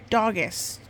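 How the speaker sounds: usual speaking voice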